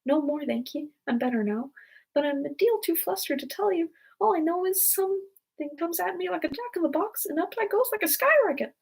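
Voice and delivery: little high-pitched squeak; small flustered creature, high and squeaky; feeble squeaky voice. A narrator's reading. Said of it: "little feeble, squeaking voice".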